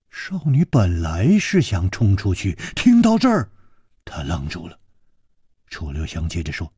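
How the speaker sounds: ordinary delivery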